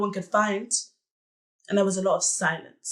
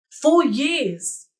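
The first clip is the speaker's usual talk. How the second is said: raised, indignant exclamation; higher-pitched cry of outrage; bitter outburst; 6 semitones higher, 4 dB louder than usual. Acted. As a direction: burst out in disbelief at the waste. Bitter outburst over lost years.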